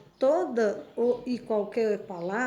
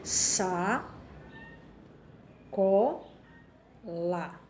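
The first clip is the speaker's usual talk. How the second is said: sa, co, lá with long pauses between syllables; 2 semitones lower, much slower than usual. Shown as a demonstration. Teacher splitting the word for the class, pausing between syllables. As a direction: break sacola into sa, co, lá. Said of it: "falar ela pausadamente".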